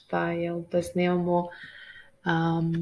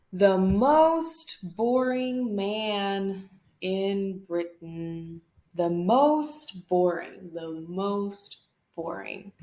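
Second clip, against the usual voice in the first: dragged-out; much slower than usual. Shown as a demonstration. Dramatized drawl on the superlative.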